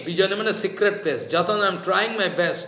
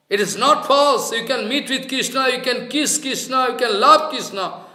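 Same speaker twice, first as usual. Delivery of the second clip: put-on raised, louder divine boy urging him on; divine voice consoling; 4 semitones higher, 4 dB louder than usual. The aerial boy tells the crying devotee to keep trying, raised and urgent. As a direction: call out like the heavenly voice, pleading. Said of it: "the aerial boy is speaking".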